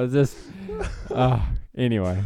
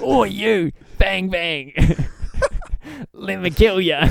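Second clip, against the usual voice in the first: Jason Statham impression; 5 semitones higher, slower than usual. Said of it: "Throw out some dialogue".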